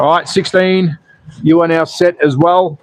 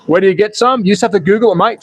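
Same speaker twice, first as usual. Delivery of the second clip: ordinary delivery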